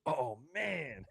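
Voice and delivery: drawn-out mock groan of dismay; retold dismay. Retelling pit crew member's embarrassment, mock dismay. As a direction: imitate a let-down friend's "oh, man". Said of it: "Not happy after that".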